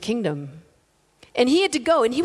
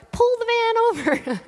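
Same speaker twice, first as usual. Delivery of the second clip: put-on much higher, piercing old-lady squawk; shrill old lady; 11 semitones higher than usual. Old lady squawking at the driver to pull over, much higher. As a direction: screech the order like a tiny old lady. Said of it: "And actually, it was more like".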